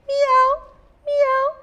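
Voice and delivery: very high-pitched mews; cat meow. A narrator's reading. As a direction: imitate a little cat, way up high. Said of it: "said the cat".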